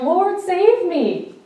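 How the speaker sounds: high, slow desperate cry for help; cried out. Acted. Peter sinking, crying out to Jesus, high slow plea. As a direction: call out to be saved from sinking. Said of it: "And he cried out".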